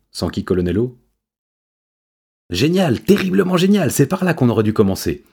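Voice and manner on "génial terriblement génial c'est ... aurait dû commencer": high-pitched burst of delight; excited, soaring praise; elated exclamation. A narrator's reading. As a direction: exclaim as if struck by brilliance. Know-it-all delighted by the idea.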